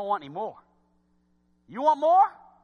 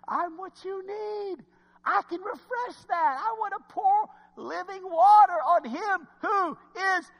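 higher, slow, tender divine promise; voice of God; 12 semitones higher, 5 dB louder, slower than usual. Acted. God speaking to the thirsty, slow lifted promise. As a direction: offer refreshment gently and grandly.

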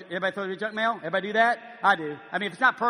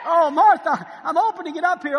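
loud and high, laughing with joy; excited; 8 semitones higher, 9 dB louder than usual. Acted. Philemon to Martha while opening the letter, giddy. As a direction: fumble the envelope in delight. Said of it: "let's act this thing out".

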